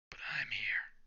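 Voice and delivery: whispered, breathless line; whispered take. Acted. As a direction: hush it like a cool secret. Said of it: "I wanted to do this in a certain way".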